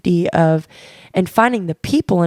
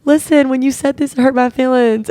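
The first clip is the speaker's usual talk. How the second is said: emotional confession; 7 semitones higher, 4 dB louder than usual. Retold or imitated. Retold telling friend she was hurt.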